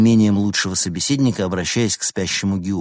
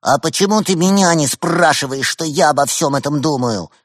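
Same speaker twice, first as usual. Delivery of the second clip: high, pushy cub demanding attention; defiant young tiger, pitched up; challenging; 8 semitones higher, 4 dB louder than usual. A narrator's reading. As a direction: demand to be asked too. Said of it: "с вызовом поинтересовался юный Уйсур".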